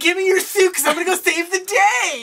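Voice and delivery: high, silly put-on voice; mock eager heroine. Acts out Felicity demanding the suit.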